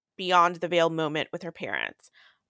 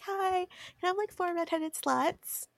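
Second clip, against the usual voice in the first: mock bar order; 11 semitones higher, 6 dB quieter than usual. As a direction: play your younger self asking the bartender sweetly. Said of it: "Be like".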